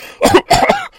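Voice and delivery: harsh retching cough. A narrator's reading. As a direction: cough like a dying man. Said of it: "made a retching coughing sound".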